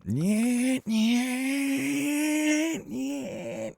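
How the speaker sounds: very high, drawn-out engine whine; imitated motorbike whine. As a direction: mimic bikes revving past in a long high squeal. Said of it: "all I can hear is just them".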